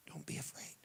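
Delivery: faint tender whisper. Acted. Jesus to a frightened believer, whispered. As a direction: let it fade into a breath.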